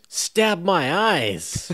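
high, drawn-out mock shout; shouted catchphrase. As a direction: cry it out like an exclamation. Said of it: "he also shouts at one point".

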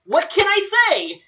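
high, put-on exasperated voice; Wei Wuxian. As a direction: throw it out high and theatrical. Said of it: "He's like".

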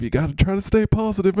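usual speaking voice

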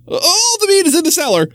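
high, eager slip of the tongue; butcher blurting. Acted. Butcher letting slip the meat is hidden, high.

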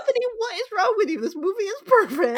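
high, fast, laughing delight; gleeful outburst. Retold or imitated. Host telling Anthony the movie is perfect, fast laughing glee. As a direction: gush at the screen through laughter. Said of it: "And so I say".

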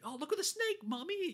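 high-pitched mock child calling to Mommy; put-on high voice, playing an eager child; mimicked excited kid. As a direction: imitate a kid squealing for mom.

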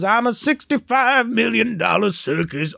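high, slow, drawn-out Southern villain drawl; Green Goblin Southern accent. Retold or imitated. Recapping the musical, the Goblin sings in a Southern accent. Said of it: "the Green Goblin has a Southern accent for no reason".

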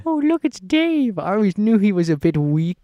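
high, loud mock British voice; British accent. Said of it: "in his British accent".

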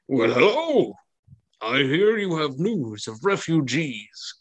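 a lightly voiced stout baron, slow; hearty nobleman welcoming guests, slowly; jovial baron. The Baron greeting the party with light jovial voice.

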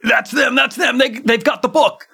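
high-pitched yelling; angry shouting merchant. Retold or imitated. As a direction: point and shout at the thieves. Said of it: "He's saying, like".

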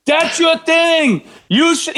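high, slow mock taunt; mocking scold. Fans scolding him over the karaoke, high mocking taunt. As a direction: parrot the critics in a high mocking voice. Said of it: "I kept getting hate from everyone going".

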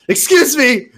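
high, loud call over a crowd; yelling through a crowd. Acted. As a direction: shout to be heard across the street. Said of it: "I yelled through the crowd".

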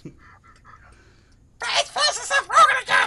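high, loud duck squawk with laughs; frantic cartoon duck yelling; Donald Duck voice. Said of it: "This is Donald Duck".

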